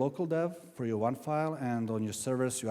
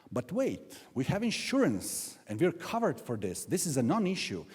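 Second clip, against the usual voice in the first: higher-pitched, mock protesting voice; objecting audience member; 6 semitones higher than usual. Developer protests that insurance covers them, mock objection. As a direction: imitate a confident objector, pitch jumping up. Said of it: "Some of you will say".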